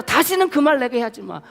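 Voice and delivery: loud, high, stern refusal; God cutting Moses off, firm and final. Acted.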